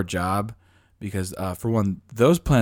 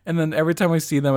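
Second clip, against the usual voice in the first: usual speaking voice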